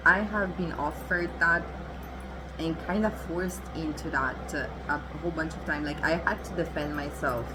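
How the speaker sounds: usual speaking voice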